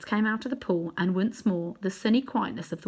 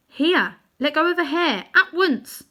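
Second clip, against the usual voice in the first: put-on higher, louder boy ordering; boy's command; 9 semitones higher, 7 dB louder than usual. A narrator's reading. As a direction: tell her firmly to let go now. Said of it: "said Diggory".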